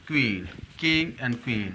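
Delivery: queen very slow with long ee. Shown as a demonstration. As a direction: stretch queen out. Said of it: "लंबा ई and एन".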